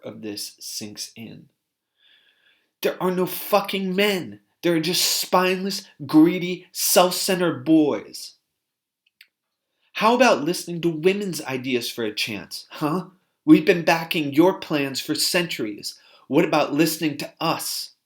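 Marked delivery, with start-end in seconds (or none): 2.8-8.3 higher and louder, spitting scorn; woman scorning spineless men, angry shout; furious retold shout. 9.9-17.9 retold anger. Former partner demands to be heard.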